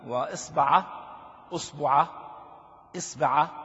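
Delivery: وإصبعه then أصبعه then إصبعه, the first vowel changing each time; variant pronunciations. Shown as a demonstration. Teacher showing students the variant vowels of the word. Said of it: "هذه فيها تسع لغات".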